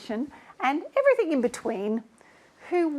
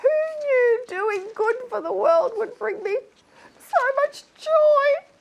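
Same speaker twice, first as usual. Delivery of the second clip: high, wailing happy-cry; tearfully overjoyed; 11 semitones higher than usual. Acted. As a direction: gush through hammed-up tears of joy. Overcome by her own good deed.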